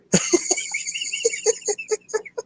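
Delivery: squeals with laughter; high squealing laugh. Real, not acted. To his viewers, reading a comment, a squealing laugh. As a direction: let a high giggle burst out.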